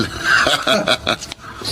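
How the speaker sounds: a falling, unvoiced mocking laugh; gloating laugh at the rival; breathy villain laugh. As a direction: sneer through the laugh.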